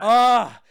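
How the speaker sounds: loud, exasperated "ah"; exasperated groan. Real, not acted. Host fed up with a game character, loud exasperated groan.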